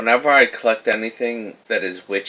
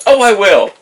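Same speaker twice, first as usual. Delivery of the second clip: much higher and louder, still laughing; 11 semitones higher, 15 dB louder than usual. Real, not acted. [name] accepts Rafim's teasing, laughing loud. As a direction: blurt it through the laugh.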